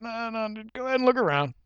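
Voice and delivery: high, quick salesman coaxing; placating salesman. Acted. Salesman calming a disgusted shopper, high placating voice.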